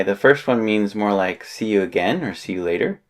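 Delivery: ordinary talk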